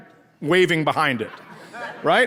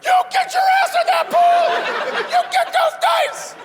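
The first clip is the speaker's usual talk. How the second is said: mad man's voice cracking octaves upward; furious high-pitched shriek. Acted. Enraged dealer ordering gambler into the pool, shrieking high. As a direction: scream it absurdly high in outrage. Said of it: "get mad enough where his voice goes up a couple octaves".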